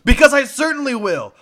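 ringing in-character promise; emphatic vow. Acted. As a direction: declare it loudly, full of resolve. Player's character vows to fix it.

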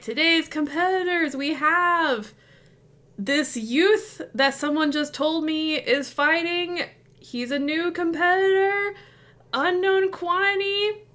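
high, showy arena announcer. Acted. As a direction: introduce the newcomer with flair. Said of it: "He's like".

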